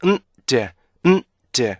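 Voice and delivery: "음, 제" as two detached beats; hummed "음", then a short "제"; separated ending. Shown as a demonstration. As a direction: split the final N and D into "음", "제". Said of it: "맨 끝에 오는 발음도요".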